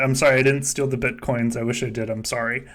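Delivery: normal talking voice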